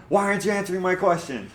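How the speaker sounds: high, loud imitation of an indignant complaint; mock angry commenter. Host imitating an annoyed viewer, mock-angry high voice. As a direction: imitate someone typing angrily in caps. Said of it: "so they were like".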